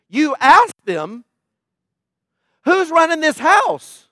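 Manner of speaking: slow, high, disbelieving challenge; incredulous. Real, not acted. Preacher scolds church parents, slow and incredulous.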